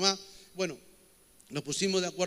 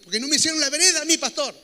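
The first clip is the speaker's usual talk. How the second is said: mock complaint; 8 semitones higher, 9 dB louder, faster than usual. Neighbor complaining to the pastor.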